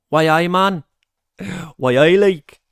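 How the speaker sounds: put-on playful, punchy Geordie accent. Streamer greeting Paul from Newcastle, mock Geordie accent. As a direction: put on a jokey Geordie catchphrase voice. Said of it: "That's my Geordie accent".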